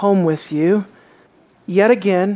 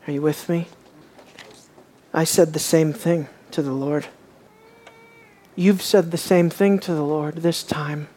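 ordinary delivery

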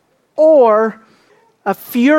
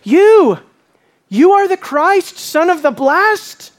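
mocking declaration; 8 semitones higher, slower than usual. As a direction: jeer it as a mock proclamation. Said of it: "He's mocking Jesus by declaring".

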